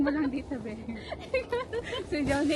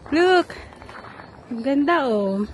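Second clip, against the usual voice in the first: normal talking voice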